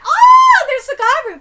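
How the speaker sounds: very high, very loud cry of discovery; excited shout. Acted. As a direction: yell when you spot it.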